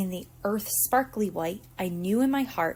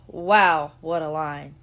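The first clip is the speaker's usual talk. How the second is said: usual speaking voice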